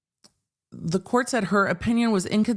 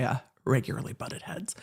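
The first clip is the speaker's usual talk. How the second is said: whispered aside; 4 semitones lower, 7 dB quieter than usual. Real, not acted.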